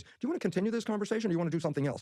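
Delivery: high and fast, a teasing inner voice; quick questioning voice. Retold or imitated. The inner voice asking him in the lab, high and quick. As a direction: rattle it off high like a voice that knows the answer. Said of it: "it said".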